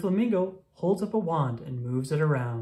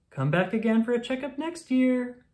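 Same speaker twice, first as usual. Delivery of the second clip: Owl's farewell; 9 semitones higher than usual. Acted. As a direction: call a bright goodbye as the owl. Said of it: "says Owl".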